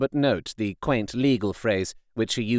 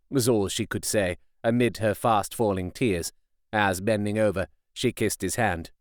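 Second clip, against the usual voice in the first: usual speaking voice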